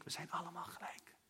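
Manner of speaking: breathy whispered repeat. Real, not acted. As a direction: whisper it to each listener. Herhaalde kernzin, gefluisterd. (gefluisterd herhaald)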